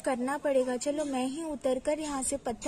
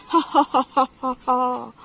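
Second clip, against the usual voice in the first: loud, measured villain laugh; hammed-up wicked cackle; evil laugh; 2 semitones lower, 8 dB louder than usual. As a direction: laugh like a gloating bandit, ha by ha. Robber laughs at his trap.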